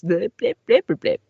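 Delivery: high, loud electronic blips; beeping noises. Pretend. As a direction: chirp like a cassette page-turn beep. Imitating cassette page-turn beeps.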